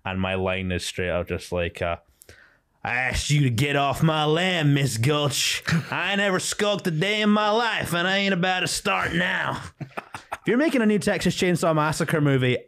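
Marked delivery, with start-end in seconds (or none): 2.8-12.6 a hammed-up Texan uncle standing up to Miss Gulch; Texan Uncle Henry. Uncle Henry on stage telling Miss Gulch off in a Texan accent. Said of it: "my Texan voice for Uncle Henry".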